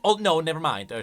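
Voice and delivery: ordinary talk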